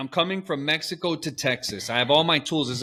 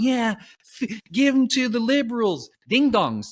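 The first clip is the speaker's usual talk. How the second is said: mock cheering; 8 semitones higher than usual. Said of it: "everybody's cheering him on".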